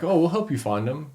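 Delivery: sweet as pie, hiding the setup; fake friendly offer. The guys offer to help before jumping him, falsely sweet.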